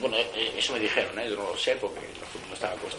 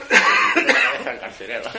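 laughing; 10 semitones higher, 9 dB louder than usual. Real, not acted. Laughing at the irony.

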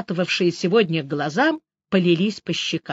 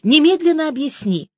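high-pitched urgent order; shouted demand; 7 semitones higher, 4 dB louder than usual. A narrator's reading. Woman demanding an explanation by phone, crying out. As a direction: demand it at once, voice raised. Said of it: "закричала я".

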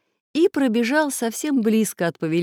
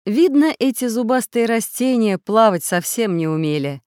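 ordinary delivery

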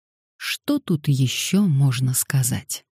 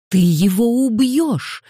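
high, drawn-out alarmed cry; shouted; 8 semitones higher, 4 dB louder, slower than usual. A narrator's reading. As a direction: cry out in alarm, pitch well up. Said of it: "прокричала Ганория". (крик, высоко и протяжно)

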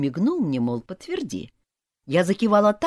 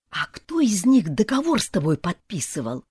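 usual speaking voice

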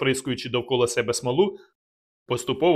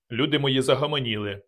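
plain reading voice

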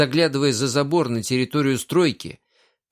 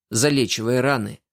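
ordinary delivery, nothing marked

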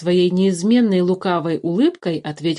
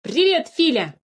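high, delighted hello; joyful greeting; 8 semitones higher than usual. A narrator's reading. Changeling greets Philipp from the pit, joyful. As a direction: greet a friend brightly from afar. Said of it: "радостно поздоровался подменыш".

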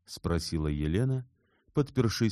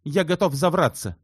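high, loud, abrupt exclamation; agitated outburst; 12 semitones higher, 8 dB louder than usual. A narrator's reading. Shubin abruptly ends the quarrel with Elena, agitated. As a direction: blurt it out and break off.

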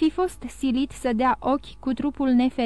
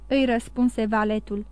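ordinary delivery, nothing marked

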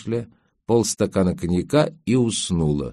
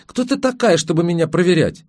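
much higher indignant attack; going on the attack; 9 semitones higher than usual. A narrator's reading. Girl counterattacks the nanny, high and indignant. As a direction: turn the tables and go at her. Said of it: "сама ринулась в атаку".

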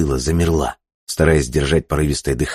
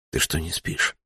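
soft hushed question; whisper; 9 dB quieter than usual. A narrator's reading. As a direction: whisper it in the dark. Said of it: "спросил Курт шёпотом".

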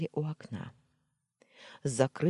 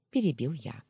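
plain reading voice

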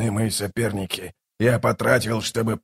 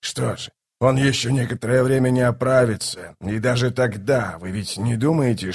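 ordinary delivery, nothing marked

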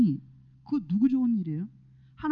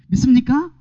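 louder call; 5 semitones higher, 10 dB louder than usual. Real, not acted. Preacher calling out louder after the crowd stays silent. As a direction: call it out louder, demanding an answer. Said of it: "이렇게 대답이 없어요".

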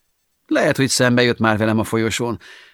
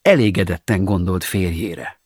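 ordinary delivery

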